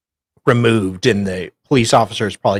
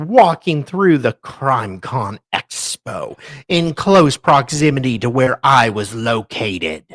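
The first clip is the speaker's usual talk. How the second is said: mock-dramatic reading; 5 semitones higher, 7 dB louder than usual. Reads court document aloud.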